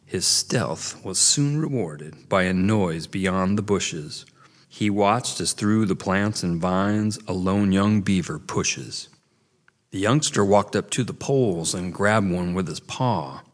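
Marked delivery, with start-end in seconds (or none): none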